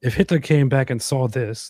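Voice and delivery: ordinary delivery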